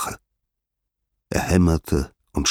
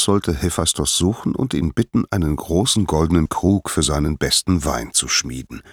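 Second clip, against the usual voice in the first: plain reading voice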